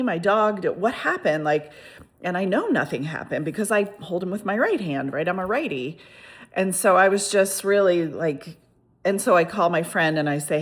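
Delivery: ordinary delivery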